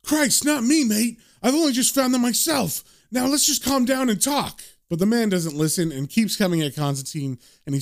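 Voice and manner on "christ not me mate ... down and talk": higher-pitched, urgent appeal; lightly acted alarmed protest; reasoning. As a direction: talk an attacker down. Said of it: "tries to reason with him".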